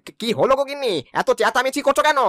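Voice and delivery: high, startled questioning; startled alarm. Acted. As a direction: call out, alarmed by the commotion. Startled husband answers wife's outcry.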